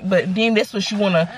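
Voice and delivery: usual speaking voice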